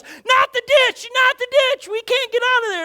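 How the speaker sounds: very high, loud panicked scream; sister yells. Retold or imitated. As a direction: shriek in terror at the ditch. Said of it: "my sister yells".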